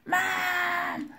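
high, drawn-out wail for mom; daughter's mock scream. Parent mimicking daughter screaming to mom for help, high mock scream. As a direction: imitate a screaming kid tattling, pitch way up. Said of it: "she's screaming".

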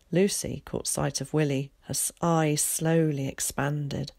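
ordinary delivery, nothing marked

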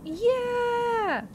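high, drawn-out whoop of joy; excited cheer. Real, not acted. Gamer finding a somber smithing stone, excited cheer.

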